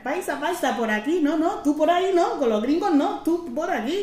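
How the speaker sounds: high, loud, insistent redirecting; imitated queue official. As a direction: mimic someone waving a traveller over. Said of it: "te dicen".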